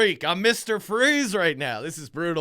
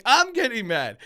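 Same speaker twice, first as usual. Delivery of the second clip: exasperated retort; 7 semitones higher, 6 dB louder than usual. Retold or imitated. Retold argument at Home Depot.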